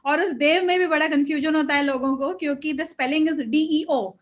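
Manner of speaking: normal talking voice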